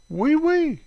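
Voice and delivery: high squealed fake French; mock French oui oui. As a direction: pop it up high like a comic Frenchman.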